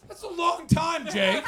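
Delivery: high, very loud outburst; high-pitched comic yell; loud exclamation. Real, not acted. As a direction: shout it in disbelief at the audience member. Exclaims at audience member's answer.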